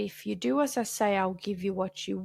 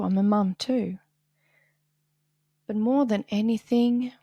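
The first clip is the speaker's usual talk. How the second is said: ordinary talk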